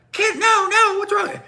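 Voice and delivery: very high squealing mock panic; panicking kids. Kids watching a train wreck, high mock panic. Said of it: "kids are like".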